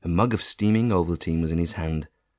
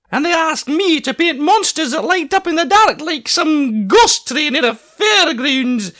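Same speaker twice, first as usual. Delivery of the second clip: high, loud indignant Scottish voice; high Scottish painter fuming about painting monsters; cross Scottish artist; 9 dB louder than usual. A narrator's reading. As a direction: scoff at the job, offended. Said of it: "said Angus crossly".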